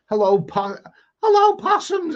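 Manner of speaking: high, loud mock Australian accent, climbing into a squeal-free falsetto on possums; Dame Edna Australian accent. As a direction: ham up an Australian drag-dame greeting. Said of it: "that's my attempt at an Australian, Australian a-, accent".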